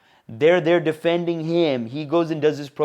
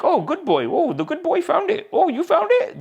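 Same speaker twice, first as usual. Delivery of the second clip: high-pitched mock praise, as if to a pet; condescending; 13 semitones higher than usual. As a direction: coo it patronizingly, way up high. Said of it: "They said it like that a little bit".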